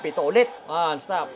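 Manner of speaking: ordinary delivery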